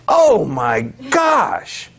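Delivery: high, drawn-out dismay; mock horror. Lecturer on what a population eats, mock horror. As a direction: stretch each word in exaggerated alarm.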